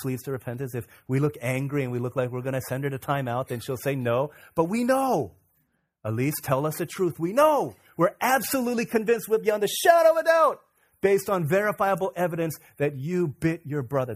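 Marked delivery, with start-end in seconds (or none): 4.5-14.2 loud, much higher insistence; fervent preaching. Acted. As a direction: hammer home the certainty. Parent confronting the child.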